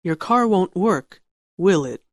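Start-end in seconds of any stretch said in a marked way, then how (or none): 1.6-2.0 "will it?" falls at the end; falling tune on "will it?"; falling tag question. Shown as a demonstration. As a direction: drop "will it?" as a sure statement. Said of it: "falling intonation or rising intonation".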